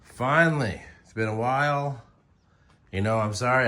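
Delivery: ordinary talk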